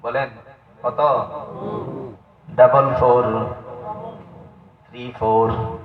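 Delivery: normal talking voice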